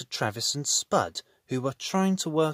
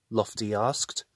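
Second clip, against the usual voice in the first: usual speaking voice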